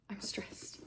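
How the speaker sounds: whispered, tense and worn out; stressed whisper. Real, not acted. Reader overwhelmed by the plot, whispering. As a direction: breathe it out under your breath.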